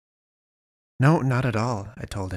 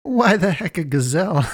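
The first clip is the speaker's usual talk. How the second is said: louder, higher indignant cry; much higher, loud disbelief; outraged; 8 semitones higher, 6 dB louder than usual. A narrator's reading. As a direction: demand it, offended. Outraged narrator continues the cry.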